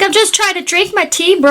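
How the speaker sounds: high-pitched retold complaint; mock exasperation. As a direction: plead in an exaggerated, put-upon way. Voices Alice's exasperation at the party.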